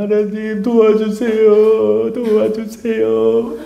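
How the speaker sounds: slow, whimpering plea to God; mock whining prayer, drawn out; whiny pleading prayer. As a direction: whine it out like a helpless child begging. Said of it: "질질질질거리고, 징징징징거리고".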